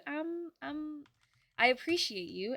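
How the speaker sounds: ordinary talk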